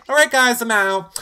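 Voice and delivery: high, loud, breezy goodbye; mock cheerful exit. Imitating Missy cheerfully leaving the game. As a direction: chirp it like nothing happened. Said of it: "She's like".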